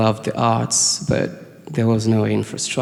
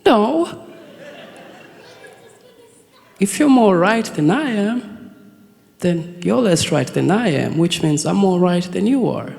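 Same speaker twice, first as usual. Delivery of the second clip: slow, high, smug mock logic with a laugh; mock bickering; 9 semitones higher, slower than usual. Teen arguing which band wrote the song, high and slow. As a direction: spell it out slowly in a squeaky know-it-all voice.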